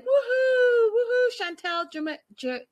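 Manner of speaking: high whooping cheer; mock cheering. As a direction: cheer like a crowd at graduation. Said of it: "and they're like".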